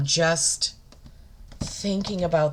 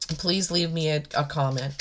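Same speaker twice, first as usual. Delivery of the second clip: normal talking voice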